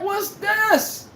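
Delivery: high, very slow baffled mock voice; mock confused player. Mocking his team's confused offense, drawn out and high. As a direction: drag it out like a lost player. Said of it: "I'm like".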